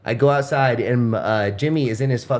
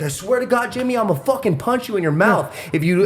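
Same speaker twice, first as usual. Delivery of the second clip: higher, heated mock threat; retold threat; 7 semitones higher than usual. Teller threatening the neighbor, mock heated. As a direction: threaten him with raised pitch. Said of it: "I was like".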